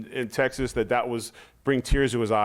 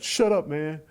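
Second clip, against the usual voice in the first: mock irritated; 7 semitones higher, slower than usual. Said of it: "he'll be like".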